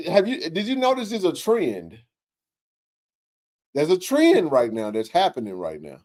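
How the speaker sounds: usual speaking voice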